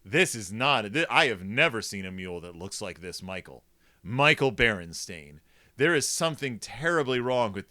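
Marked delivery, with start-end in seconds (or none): none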